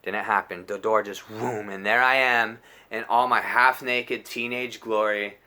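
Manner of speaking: normal talking voice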